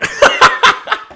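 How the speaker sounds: loud, high laughing fit; burst of laughter. Real, not acted. Host after the light got tipped over, loud laughter.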